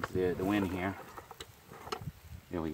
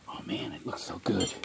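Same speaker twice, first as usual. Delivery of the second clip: hushed, admiring whisper; 8 dB quieter than usual. Real, not acted. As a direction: breathe it out in awe. Admiring the meat close up.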